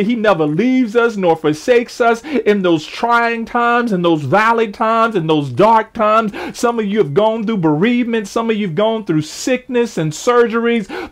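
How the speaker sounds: ordinary delivery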